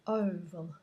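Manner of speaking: oval with lips well rounded on the first o. Shown as a demonstration. Teacher reminding students to round lips, oval. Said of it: "So remember to round your lips".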